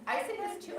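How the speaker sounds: usual speaking voice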